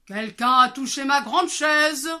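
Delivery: loud grumbling papa bear; big bear growling. Acted. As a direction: roar it like a big angry bear. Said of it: "le grand ours gronda de sa grande voix".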